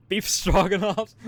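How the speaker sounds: high, loud, slow laughing echo of the name; laughing disbelief. Real, not acted. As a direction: crack up repeating the name.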